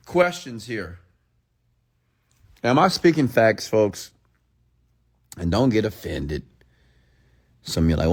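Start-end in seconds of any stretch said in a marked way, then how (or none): none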